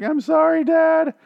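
put-on a high, timid girl saying hi to her dad; teen daughter voice. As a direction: play the meek daughter at a high pitch. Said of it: "she walks in and she's like".

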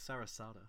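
"Sarasota" with crisp T's, English style; British "Sarasota" with clear T sounds; English, two T's. Shown as a demonstration. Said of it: "I'd say it as if there's two T's".

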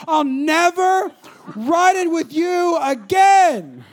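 slow, loud, high outrage; drawn-out mock fury; indignant vow. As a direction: imitate a fuming mom, stretching each word. Mom storms out of car, retold.

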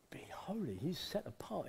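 hushed, breathless "holy"; awed whisper. Real, not acted. Preacher whispering of God's holiness in awe. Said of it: "awe at God's holiness".

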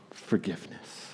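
soft, whispered answer; hushed cry. Acted. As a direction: drop right down to a whisper. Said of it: "Jesus' blood cries out".

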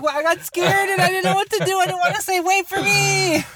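high mocking voice, slowing at the end; high, teasing take on a scared kid's excuse.

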